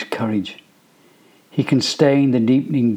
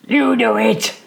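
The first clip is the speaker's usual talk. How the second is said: yelling back; 11 semitones higher, slower than usual. A narrator's reading. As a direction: fling it back at him. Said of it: "he yells back".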